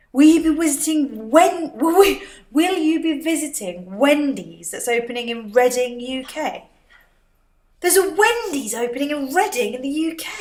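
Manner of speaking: high, loud and thrilled; excited. Real, not acted. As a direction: read it out full of excitement. Reads comment, excited.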